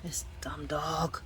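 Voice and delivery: whispered grumble at the dog; muttered under breath. Real, not acted. Muttering to the dog in the flooded basement, exasperated.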